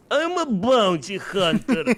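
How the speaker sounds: put-on slow, high Russian accent; a hammed-up Russian bounty hunter. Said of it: "revoice this, uh, class with my voiceover".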